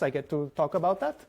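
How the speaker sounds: ordinary talk, nothing marked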